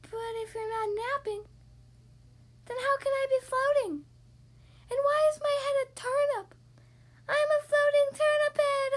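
high, loud silly pig, rising with glee; giddy dreaming pig. Acted. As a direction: giggle at the absurd dream.

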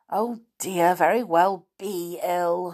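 a touch of desperate reluctance; mildly desperate surrender; mild desperation. A narrator's reading. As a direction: give in, understated desperation. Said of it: "said Flip desperately".

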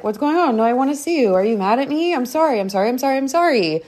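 imitating her own panicked texts; anxious pleading. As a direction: plead frantically, apologizing over and over. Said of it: "you can see me already being anxious".

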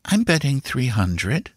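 put-on high, slow child's voice; small boy stating it gravely. A narrator's reading. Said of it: "said the boy gravely".

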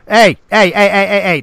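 shouted hey hey, high and loud; loud protest. Real, not acted. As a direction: call them out, loud. Player protesting loudly.

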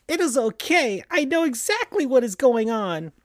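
high falsetto cartoon sidekick; Tails, reassuring know-it-all. A narrator's reading. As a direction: squeak out calm reassurance in falsetto. Tails voiced in narrated story.

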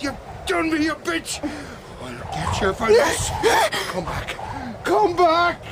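high enraged yell of a blinded brute; sputtering foiled villain. Acted. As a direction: scream after her, beaten and furious. Blinded villain rages after her.

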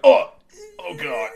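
very high, strained, pained mock groan; mocking the guy straining in the stall, high and pained. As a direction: squeeze it out like a pained grunt on the toilet.

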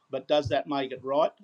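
ordinary delivery

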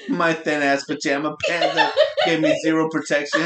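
loud laughing protest; laughing shout. Real, not acted. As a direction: yell it through the laughter. Laughing complaint about thin pants.

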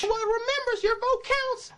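very high put-on female voice; mock woman's voice. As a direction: squeal it as a caricatured woman. Acted woman's reply in a story.

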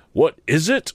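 high, flustered Mr Wopsle voice; fussy pompous man. A narrator's reading. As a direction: echo it high, thrown off. Wopsle repeats the question.

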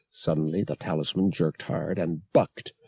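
plain reading voice

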